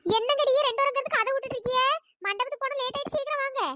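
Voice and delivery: put-on high-pitched elder chivvying the girls; hurrying elder woman. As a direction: call them along in a high voice. Elder hurries the dawdling girls.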